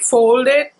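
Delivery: ordinary delivery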